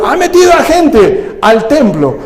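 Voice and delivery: hammed-up indignant accuser; mock accusation. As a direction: tattle it like a false accuser. False accusers voiced in retelling.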